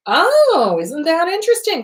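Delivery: high, airy feigned interest; mock intrigued. Speaker greeting an unwanted thought at the door, mock intrigued. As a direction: greet it with a big high "oh", amused and unbothered.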